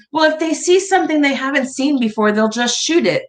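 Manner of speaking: lightly voiced dry grandma joke; a hint of a wry old lady; grandma's quip. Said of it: "She goes".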